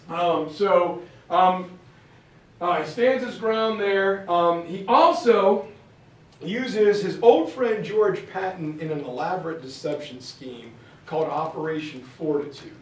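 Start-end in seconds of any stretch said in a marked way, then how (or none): none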